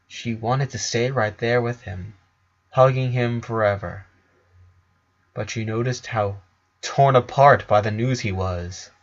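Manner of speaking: normal talking voice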